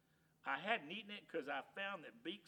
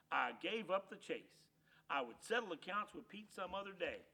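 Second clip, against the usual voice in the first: ordinary delivery, nothing marked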